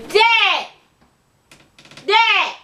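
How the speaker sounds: loud, high call to Dad. Real, not acted. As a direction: yell across the house for her father. Calling out to her dad.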